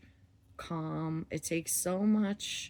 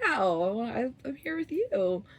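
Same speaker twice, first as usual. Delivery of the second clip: imitating husband's refusal; 5 semitones higher, 5 dB louder than usual. Husband refusing, voiced by wife.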